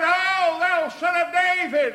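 high, full-voiced desperate shout; crying out. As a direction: blind man calling out for help. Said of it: "He began to cry out".

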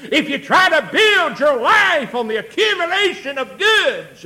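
loud, high sermon cry; full-force preaching. Real, not acted. Preacher warning against living for goods, full-force preaching. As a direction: push the warning up into a shout.

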